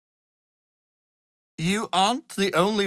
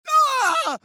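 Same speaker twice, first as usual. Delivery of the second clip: sharp high cry; 5 dB louder than usual. Acted. Wizard cries out abruptly.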